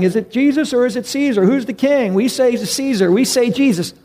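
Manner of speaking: a lightly voiced yelling mob; crowd yelling. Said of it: "they're yelling".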